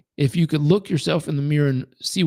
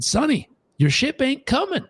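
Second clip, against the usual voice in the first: put-on old man voice; 9 semitones higher than usual. Homeless old man advises tycoon.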